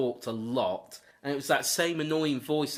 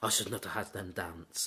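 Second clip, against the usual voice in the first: repeated even lower and softer, almost to himself; quieter echo; 10 semitones lower, 9 dB quieter than usual. Retold or imitated. Vladimir repeating his regret, fading to a low murmur. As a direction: let the regret fade into a murmur. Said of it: "Vladimir actually says".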